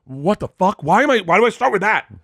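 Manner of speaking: high, loud outburst at himself; exasperated self-reproach. Pretend. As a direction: play horrified at your own opening line. Berating himself after role-played introduction.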